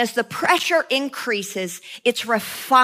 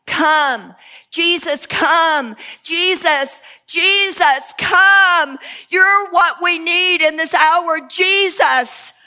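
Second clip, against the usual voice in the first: rising louder and higher into a fervent plea; fervent cry of Come Jesus, building; building prayer cry; 4 semitones higher, 3 dB louder, slower than usual. Shown as a demonstration. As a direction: climb from a call to an impassioned cry. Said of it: "crying this cry".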